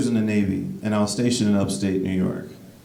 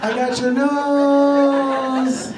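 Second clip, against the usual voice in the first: very high, very slow, louder squeal; squealing high gag; 4 dB louder, much slower than usual. Pretend. Comedian repeating nose gag to girlfriend, high drawn-out squeal. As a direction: squeal it out like a kid's party trick. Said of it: "repeat of the gag".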